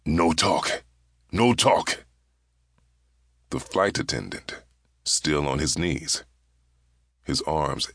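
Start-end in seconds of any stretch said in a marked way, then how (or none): 0.1-2.1 harsh guttural command, rising higher and louder; guttural hiss. A narrator's reading. Hijacker silencing the captain after striking him, guttural hiss. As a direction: hiss it from the throat, sharper on the repeat. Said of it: "Goshi's voice was a guttural hiss".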